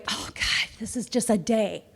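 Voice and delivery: breathy, worn-out sigh of a frazzled worker; a lightly voiced overwhelmed employee; half-whispered mock exasperation; stressed employee. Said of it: "you have an employee going".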